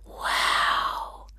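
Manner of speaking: whispered wow; breathed wow. Acted. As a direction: breathe it in awe. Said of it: "breathed Tanu".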